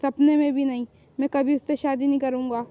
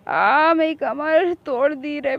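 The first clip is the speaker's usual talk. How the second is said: pained groan; much slower than usual. Acted. Back hurt after being beaten.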